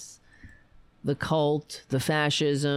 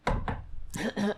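throat clearing; 5 semitones higher, 5 dB quieter than usual. Real, not acted. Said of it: "And now I'm choking".

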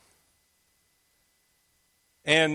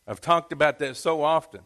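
usual speaking voice

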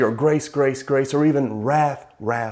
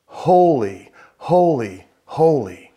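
louder, rising calls of holy; seraphim crying out; 4 semitones higher, 5 dB louder, slower than usual. Retold or imitated. Preacher voicing angels crying holy, lifted and loud. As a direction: call it out as the seraphim do. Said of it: "they cry out to one another".

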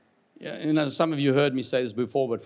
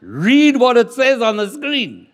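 high, loud, drawn-out order; emphatic shout; 8 semitones higher, 10 dB louder, slower than usual. Real, not acted. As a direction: shout it slowly at the whole room. Prods congregation to read correctly.

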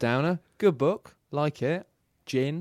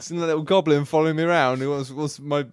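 loud, high mock-excited bloke; 3 semitones higher, 6 dB louder than usual. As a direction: ham up a chatty fan. Mock quoting a fan in-character.

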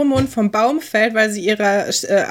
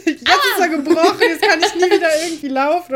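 higher, hammed-up wailing about a broken foot; exaggerated fake pain, higher pitched; mock dramatic whine; 6 semitones higher than usual. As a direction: overact the injured victim. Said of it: "und die so".

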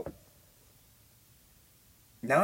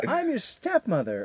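slow, much higher creepy repeat; eerie stepmother; 11 semitones higher, slower than usual. Retold or imitated. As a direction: say it slower and higher, insisting. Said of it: "she goes".

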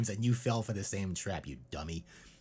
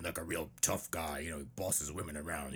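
ordinary talk, nothing marked

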